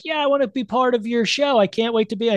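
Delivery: high eager mock voice; imitating Whoopi. Whoopi begging to be on the show, high eager mimicry. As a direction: gush it, dying to join the show. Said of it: "she's like".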